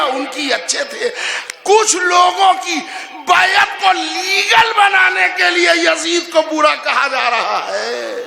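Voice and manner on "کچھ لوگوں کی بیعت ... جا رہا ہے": loud, high and slow, pounding each phrase; fiery preaching. Acted. Preacher to congregation, driving home his point at full force. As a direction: thunder it from the pulpit.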